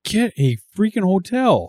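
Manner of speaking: half-joking exasperated husband; telling her to just get a hotel, exasperated; exasperated order. Retold or imitated. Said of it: "And I was like".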